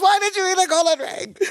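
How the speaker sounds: very high-pitched imitated wail; mock frantic outburst. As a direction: act out grabbing and shaking someone. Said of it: "grabs him and shakes him and goes".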